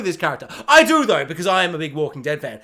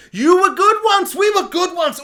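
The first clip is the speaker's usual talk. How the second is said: mock heroic plea; 10 semitones higher, 5 dB louder than usual. Henchman pleading dramatically, high and loud, hammed up. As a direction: overact the henchman's noble turn. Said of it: "he'll be like".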